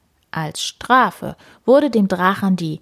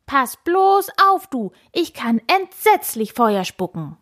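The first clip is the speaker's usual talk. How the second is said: high-pitched dragon threat; aggressive warning; 8 semitones higher than usual. A narrator's reading. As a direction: threaten them, itching to fight. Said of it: "schrie Koks angriffslustig". (angriffslustig drohend)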